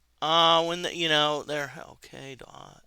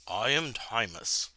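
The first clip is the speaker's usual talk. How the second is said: stately wizard; 10 semitones lower, 11 dB quieter than usual. Acted. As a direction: speak low and unhurried like an old sage. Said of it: "He says".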